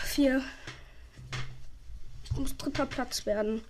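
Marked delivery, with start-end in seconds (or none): none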